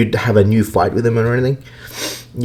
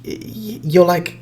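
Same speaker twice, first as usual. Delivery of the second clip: normal talking voice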